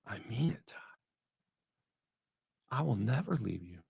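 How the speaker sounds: soft, low reassuring voice of God; tender hush. Acted. God speaking to Todd, soft tender reassurance. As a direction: reassure him gently, almost whispering. God's hushed reply.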